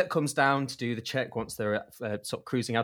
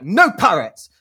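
much louder, higher snap; angry shout; 8 semitones higher, 13 dB louder, slower than usual. Retold or imitated. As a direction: bark it triumphantly. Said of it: "It's like".

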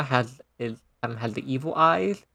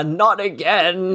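high, drawn-out mock cry; mock wail; 5 semitones higher, much slower than usual. Host quoting Grandpa getting knocked out, drawn-out mock wail. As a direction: stretch it out in a raised, dismayed voice.

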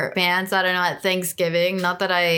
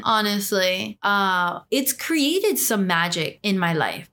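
normal talking voice

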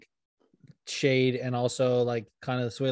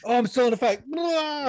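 mock fighter waking; 11 semitones higher than usual. Co-host mocking fighter who woke from coma, high goofy yell. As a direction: ham up a dazed fighter rising again.

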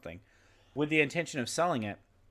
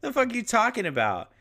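baffled; 9 semitones higher, 5 dB louder than usual. Retold or imitated.

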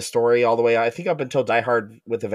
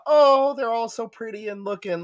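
mock gushing; 6 semitones higher than usual. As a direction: coo it like a swooning fan, pitched up. Mocking gushy reactions to movie kisses.

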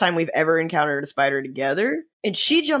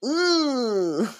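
mock knowing ooh; 6 semitones higher than usual. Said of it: "where people go".

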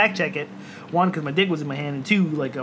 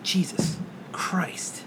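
slow, breathless whispered Jesus Christ; shocked whisper; 9 dB quieter, much slower than usual. Retold or imitated. Retelling to a friend how he gasped at a gory clip, whispered. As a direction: let it slip out in a hushed, horrified whisper.

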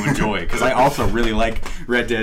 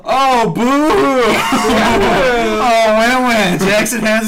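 much higher, louder mock boo with a laugh; mock outrage; 12 semitones higher, 7 dB louder, slower than usual. Real, not acted. Friend playfully protests being spoiled, high laughing whine.

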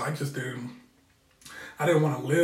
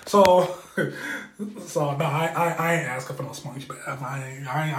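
ordinary talk, nothing marked

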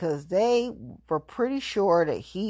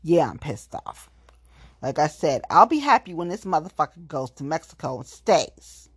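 normal talking voice

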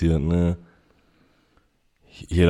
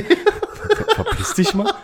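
much higher, laughing as he tells her to get lost; laughing brush-off; slower than usual. Retold or imitated. Retelling how he shooed off the granny, laughing and much higher. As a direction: giggle the insult out, pitched way up. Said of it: "ich sag".

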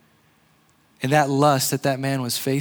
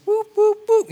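mock sword swooshes; 6 dB louder than usual. Mimicking a sword swinging at sin.

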